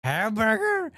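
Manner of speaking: put-on a kid who can't believe what he's been served; disgusted kid. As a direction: mimic a grossed-out child staring at his food. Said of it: "he's like".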